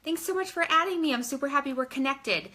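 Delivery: upbeat hello to a new contact; bubbly greeting. Shown as a demonstration. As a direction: gush a little as you say hi. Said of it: "And I'll just say".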